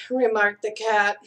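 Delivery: usual speaking voice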